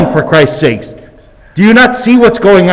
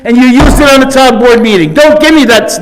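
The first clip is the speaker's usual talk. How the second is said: defiant raised voice; 3 semitones higher than usual. Real, not acted. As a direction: push back hard at the chair. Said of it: "lower my voice".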